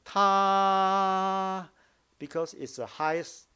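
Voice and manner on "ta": "Ta" held very long, high and level; loud, high, steady, very long "Ta"; prolonged first tone. Shown as a demonstration. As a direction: stretch "Ta" on one high pitch. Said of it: "very long".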